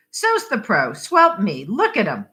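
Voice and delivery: ordinary delivery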